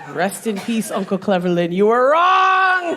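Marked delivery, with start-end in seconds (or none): 1.7-3.0 loud, high, drawn-out holler; comic yell of reproach, pitched up; mock shout. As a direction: holler it, stretching the last word. Mock shout to dead uncle.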